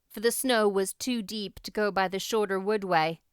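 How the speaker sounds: plain reading voice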